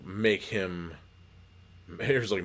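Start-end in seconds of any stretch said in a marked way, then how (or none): 0.0-0.9 scientist breaking off to hint at the horror, trailing off on "make him"; "make him" left hanging, unfinished. Acted. Explaining the parasite to Jake, trailing off on "make him".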